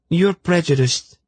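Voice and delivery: high, pointed accusation; accusing. A narrator's reading. Zenedra accusing Silk, high accusing voice. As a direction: accuse him flatly. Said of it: "she accused".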